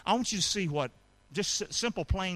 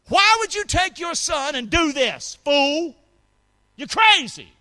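mocking scold; 9 semitones higher, 8 dB louder than usual. Mock critic scolding Abraham.

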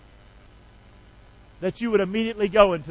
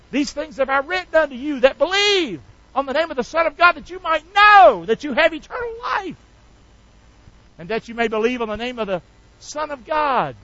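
scripture reading; 7 semitones higher than usual. Acted.

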